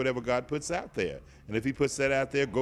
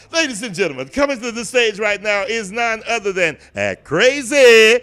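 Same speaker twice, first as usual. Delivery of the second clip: booming announcer version; 7 semitones higher, 11 dB louder than usual. Shown as a demonstration. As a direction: belt it out like a big church-stage emcee. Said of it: "Now, what's the difference?".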